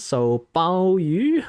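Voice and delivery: slow, drawn-out try at "Bao Yu"; tentative name guess. Shown as a demonstration. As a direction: sound out "Bao Yu" unsure, slowly. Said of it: "I don't know how to pronounce".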